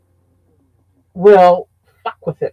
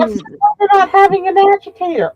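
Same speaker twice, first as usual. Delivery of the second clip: puzzled quoted question; 11 semitones higher than usual. Retold or imitated. Retelling sister's laundry argument.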